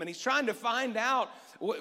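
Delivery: ordinary talk, nothing marked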